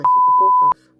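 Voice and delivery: sudden high, loud frustrated yell; angry outburst. Real, not acted. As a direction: blurt it out in real irritation. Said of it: "죄송해요. 욕해가지고".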